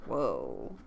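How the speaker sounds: plain reading voice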